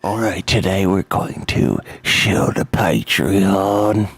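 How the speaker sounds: low, slow show-opener voice; put-on announcer voice. Game master plugging the Patreon, deep slow put-on voice.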